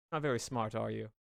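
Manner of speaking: soft, low condescension; condescending. Acted. Kobold belittling a companion, quietly condescending. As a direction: talk down to them quietly. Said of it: "condescending way possible".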